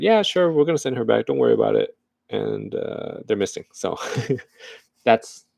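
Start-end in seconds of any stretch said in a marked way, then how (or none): 0.0-2.0 mock reassurance, starting much higher; sarcastic brush-off. Israel answers Sweden, sarcastic mock reassurance. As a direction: insincere sure-sure voice. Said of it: "And Israel was like".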